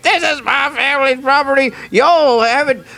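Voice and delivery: sputtering foiled villain. Retold or imitated. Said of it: "who's just coming in and just shouting".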